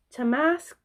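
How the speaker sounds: "to mask" rises high like a grand recital; dramatic. Shown as a demonstration. Vlogger parodying Hamlet, theatrical rise on "mask". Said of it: "wordplay on Hamlet".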